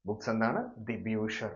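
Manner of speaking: normal talking voice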